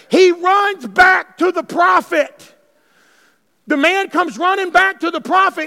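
slow shouted telling; preaching shout. Acted. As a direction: belt it out to the church. Preaching at full force.

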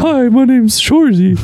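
very high, slow cartoon puppet voice; Elmo. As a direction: pitch it way up and draw out a childlike introduction. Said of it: "you're not Elmo".

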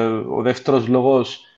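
ordinary talk

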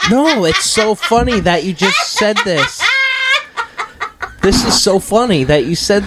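laughing hard while talking. Real, not acted. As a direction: break up laughing. Laughing at the question.

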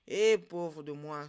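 slow, loud lament; sighing. A narrator's reading. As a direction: sigh it out in slow self-pity. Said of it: "soupira-t-il". (soupir de lamentation, lent et appuyé)

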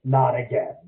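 low, sinking dismay; voiced dismayed reaction. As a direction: drop the voice as if dreading a repeat. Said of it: "I can only describe it as".

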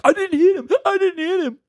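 put-on very high, pleading child voice; kid protesting. Kid protesting innocence to the angry dad, very high pleading. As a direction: beg to be believed like a little kid. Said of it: "And you're like".

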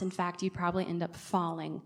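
ordinary talk

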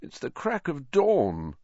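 croaking, half-awake complaint; croaky protest. A narrator's reading. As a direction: drag it out in groggy protest. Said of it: "Harry croaked".

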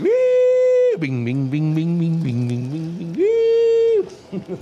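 high squealing "wee", then a run of chanted "bing"; sound effect imitation. Mocking Ricky's old self with slot machine noises. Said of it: "It would have been like".

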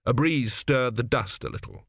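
ordinary delivery, nothing marked

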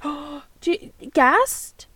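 high, loud mock-urgent question; gasping, hammed-up plea; acted request. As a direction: ask it high and loud, as a playful catchphrase. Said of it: "just say".

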